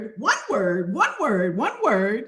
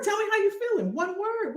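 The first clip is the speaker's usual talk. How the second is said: ordinary talk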